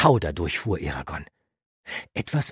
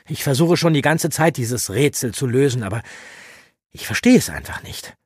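loud, higher frustrated youth; frustrated pleading; 10 semitones higher, 8 dB louder than usual. Acted. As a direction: beg for answers, exasperated. Eragon verzweifelt fragend. (verzweifelt fragend, laut)

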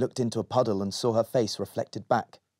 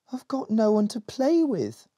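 protesting; 10 semitones higher than usual. Acted. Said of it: "protested Molly".

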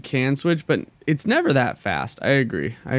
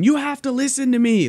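mock yell; 12 semitones higher than usual. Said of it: "like, yelling".

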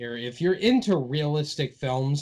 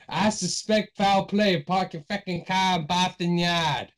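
hammed-up Boston accent, pitched higher; bad Boston accent; 5 semitones higher than usual. Shown as a demonstration. Host redoing the line for a teasing co-host, exaggerated Boston accent. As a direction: lay the Boston accent on thick. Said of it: "god awful Boston accent".

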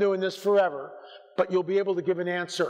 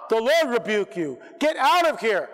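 commanding rebuke; 2 semitones higher than usual. Shown as a demonstration. Preacher demonstrating rebuking a demon, shouted and commanding. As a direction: order the spirit away. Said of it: "Get angry".

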